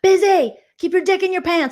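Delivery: loud, high yell from the wall; high shouted heckle; wall yeller shout. Pretend. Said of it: "I'll be the wall yeller".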